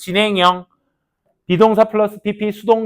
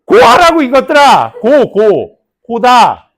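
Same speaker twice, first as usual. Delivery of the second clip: loud and much higher, scolding; exasperated shout; 7 semitones higher, 14 dB louder, much slower than usual. Real, not acted. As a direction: yell the word at the class. 학생들에게 외치기. (학생들에게 외치듯 큰 소리로 고다)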